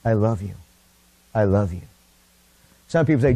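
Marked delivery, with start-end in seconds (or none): 0.0-0.5 tender "I love you". Shown as a demonstration. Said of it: "went to the Lord and said". 1.3-1.8 tender devotion. Pretend. Tender words spoken to the Lord.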